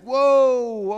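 loud, high, drawn-out surprise; startled whoa. Acted. Reacting to someone who is really mad, exaggerated astonishment. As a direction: act caught off guard. Imagined reaction to unexpected anger.

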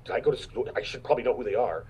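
normal talking voice

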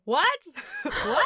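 put-on high, bewildered little girl "What?"; confused girl. Imitating the blind daughter looking around, high-pitched. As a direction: squeak it like a lost child. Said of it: "all you saw the daughter do was go".

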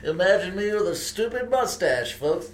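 bored ironic hipster drawl. Acted. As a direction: sound smug and too cool. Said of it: "I guess it's like hipster voice".

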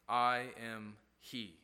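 low, slow and weighty; solemn declaration. Acted. Jesus answers the soldiers, slow low solemn voice.

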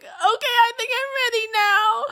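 high, squeaky sobbing voice; mock crying. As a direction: wail it as if trying to talk through tears. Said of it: "just fucking crying in the car".

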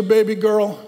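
ordinary talk, nothing marked

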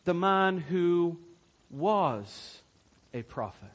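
The man who... was... a prophet, slow with pauses; stress on was. Shown as a demonstration. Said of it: "Look at the tense".

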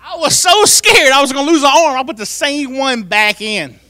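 normal talking voice

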